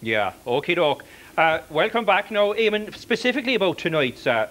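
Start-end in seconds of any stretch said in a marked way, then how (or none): none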